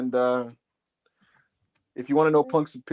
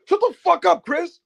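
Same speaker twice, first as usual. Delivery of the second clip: high-pitched, worked-up retort; imitated yelling. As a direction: yell it high and fast, acting out his outrage. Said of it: "Why are you yelling at me".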